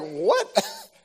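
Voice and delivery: high startled yelp; a squeaky stunned what; surprised. Retold or imitated. Caught off guard by his friend, high surprised squeak.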